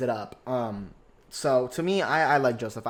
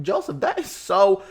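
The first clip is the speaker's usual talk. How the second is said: higher, louder and slowed, shocked; shocked gasp; 6 semitones higher, 5 dB louder than usual. Retold or imitated. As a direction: gasp it out scandalized. Reacting to the rude greeting.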